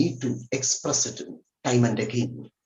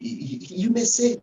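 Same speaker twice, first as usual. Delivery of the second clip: usual speaking voice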